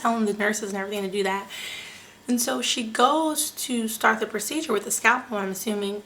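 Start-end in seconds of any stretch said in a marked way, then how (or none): none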